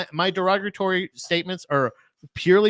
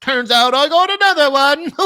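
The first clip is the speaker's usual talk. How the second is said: mock wizard; 10 semitones higher, 7 dB louder than usual. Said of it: "And they go".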